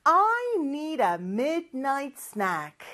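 slowly, each word drawn out clearly; measured teacher demonstration; slow model sentence. Shown as a demonstration. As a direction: say it for learners to repeat. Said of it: "큰 목소리로 따라해볼까요".

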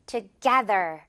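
together with the middle syllable GE punched; stress on GE. Shown as a demonstration. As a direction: hit the middle of together, tu-GE-ther. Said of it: "깨 부분을 확 강하게요". (together 가운데 깨 음절에 강세)

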